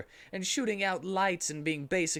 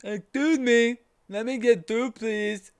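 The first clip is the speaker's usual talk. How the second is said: loud, higher dopey voice; big dopey voice; 8 semitones higher, 7 dB louder than usual. A narrator's reading. Lunch lady pushing through the crowd, loud and goofy. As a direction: push through the crowd goofily.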